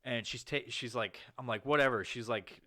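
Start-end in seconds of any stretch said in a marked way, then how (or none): 1.7-2.0 dismissive whatever. Retold or imitated. Dismissive retold reply.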